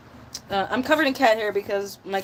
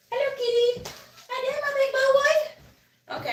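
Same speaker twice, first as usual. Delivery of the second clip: high, doting pet-owner voice; pet talk; slower than usual. Real, not acted. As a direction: coo a high greeting to a beloved cat. Greeting the cat in pet voice.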